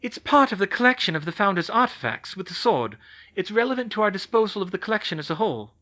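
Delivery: a hint of a brisk schoolgirl; Hermione. Hermione argues the collection.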